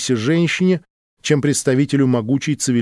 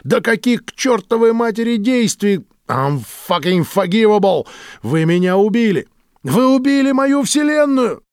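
high, raw accusation; blaming them for his ruined world; outraged; 8 semitones higher than usual. Acted.

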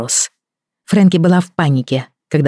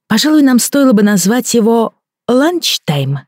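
playful joking voice; 8 semitones higher than usual. A narrator's reading. Frankie joking to the child.

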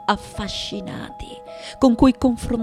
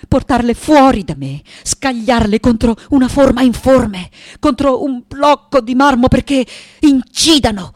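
loud, driving, mounting force; fierce outpouring; 4 semitones higher, 8 dB louder than usual. Acted. Artist casting her demons onto marble, fierce and loud. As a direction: hurl each verb out forcefully.

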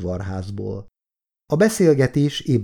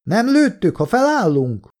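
high, wary shouted question; raised voice over distance; calling back; 11 semitones higher, 3 dB louder than usual. A narrator's reading. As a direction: call across to make sure it is safe. Calls back across to the trenches.

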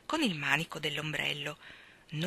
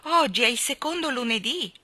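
higher, louder stubborn voice; insisting from inside; 7 semitones higher, 5 dB louder than usual. Acted. Cousin insisting through the door it is second Monday. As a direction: insist you are out.

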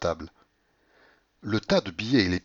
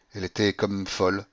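ordinary delivery

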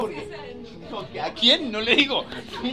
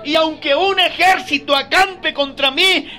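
loud, higher, slow proclamation; preacher declaring the psalm, slow and loud; scripture reading at full force; 6 semitones higher, 8 dB louder, slower than usual. Acted. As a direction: proclaim each verse slowly to the church. Reads psalm 27 aloud.

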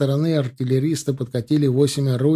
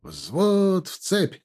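higher, very slow barked command; barked order; 6 semitones higher, much slower than usual. A narrator's reading. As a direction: bark it at the platoon. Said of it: "рявкнул Тимофей".

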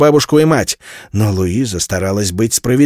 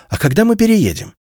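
lightly voiced girl asking, higher; girl's question; 10 semitones higher than usual. A narrator's reading. A daughter asks her mother when they move, high and eager. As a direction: ask like an eager young daughter.

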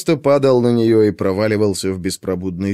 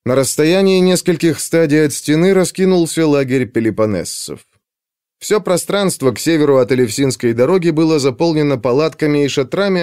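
usual speaking voice

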